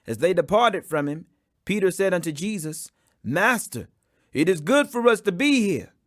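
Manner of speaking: plain reading voice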